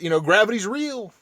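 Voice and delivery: normal talking voice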